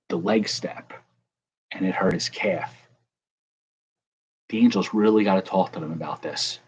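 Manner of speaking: ordinary talk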